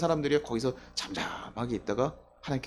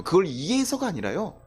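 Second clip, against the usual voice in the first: normal talking voice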